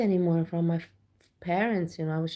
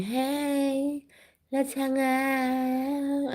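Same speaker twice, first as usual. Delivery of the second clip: higher, very slow lazy mock streamer voice; 7 semitones higher, much slower than usual. Mocking boring streamers, higher drawn-out put-on voice. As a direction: drag it out like a dull streamer. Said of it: "just streaming".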